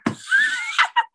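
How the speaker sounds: high, surprised laugh; a sudden squeal of laughter; high burst of laughter. Real, not acted. Laughing at the coincidence to the host.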